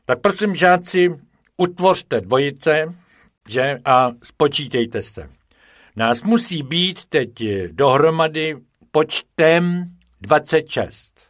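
emphatic teacher's announcement; teacher giving orders. A narrator's reading. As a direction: order the class to line up and count. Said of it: "učitel Kalousek vystoupil první a nařizoval".

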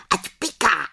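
high, gleeful witch screech; cackling crowd of witches; witches shrieking. Acted. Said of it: "vřískaly".

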